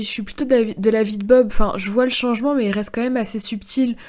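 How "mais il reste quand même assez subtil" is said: ordinary delivery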